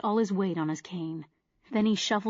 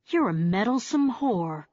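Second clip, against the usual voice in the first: irritated. Acted. Hodor turning on Darby with irritation, irritated. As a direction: let the irritation spill over. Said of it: "the irritation growing in his face".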